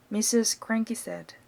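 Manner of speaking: plain reading voice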